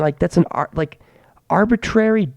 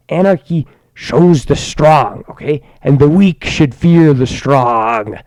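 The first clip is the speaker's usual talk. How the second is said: ominous orator; 5 dB louder, slower than usual. Acted. Ominous rally speech.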